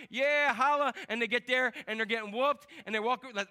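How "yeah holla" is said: loud, high cocky whoop; brash victory call; cocky cheer. Acted. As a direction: celebrate too early. Boastful kings before battle.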